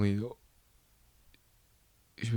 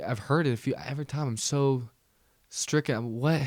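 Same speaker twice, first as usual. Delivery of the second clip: ordinary talk, nothing marked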